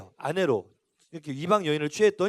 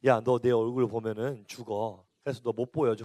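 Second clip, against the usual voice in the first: God's voice; 9 semitones lower, 5 dB quieter than usual. Retold or imitated. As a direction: speak down gently as God refusing a friend. God answers Moses in story. (낮고 느린 하나님 목소리)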